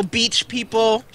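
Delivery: ordinary delivery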